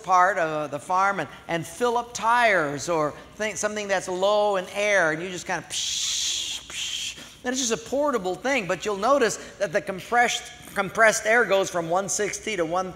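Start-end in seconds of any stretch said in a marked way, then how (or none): 5.7-7.2 air hiss. Retold or imitated.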